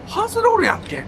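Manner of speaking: high loud disbelief; shocked exclamation. Real, not acted. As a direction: yelp it in disbelief. Shocked at the plane.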